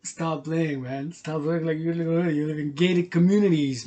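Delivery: loud teasing callout; taunting. Real, not acted. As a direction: call the rappers out. Taunting gated community rappers.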